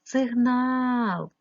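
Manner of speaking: «Сигнал» with the stress on «нал», said loud and slow; stress on the second syllable. Shown as a demonstration. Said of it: "Постав наголос". (наголос на «нал» у слові «Сигнал»)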